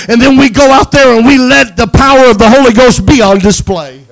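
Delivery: loud, soaring pulpit cry, pushing every phrase; rally the congregation like a revival preacher; full-force preaching. Acted. Pastor exhorting the congregation to be two-handed Christians, loud and fervent.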